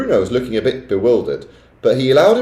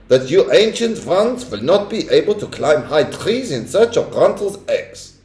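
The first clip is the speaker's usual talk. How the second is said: witch speech; 8 semitones higher than usual. A narrator's reading. Witch lecturing the ancient ones, high and scornful.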